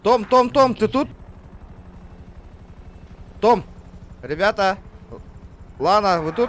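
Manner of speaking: yelling across the room. Real, not acted. Calling teammates over voice chat.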